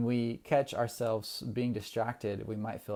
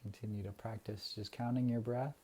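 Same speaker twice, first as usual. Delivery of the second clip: guided meditation. Acted. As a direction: ease the listeners into calm breathing. Leads a breathing meditation.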